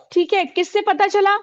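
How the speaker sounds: ordinary talk, nothing marked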